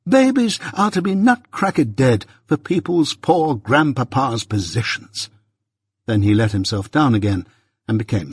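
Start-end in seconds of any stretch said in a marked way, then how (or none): 0.1-5.3 high, slow, incredulous protest; drawn-out exasperated outcry, sinking at the end; helpless despair. A narrator's reading. Mister Pocket railing to the elements about babies, despairing. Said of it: "he helplessly exclaimed to the elements".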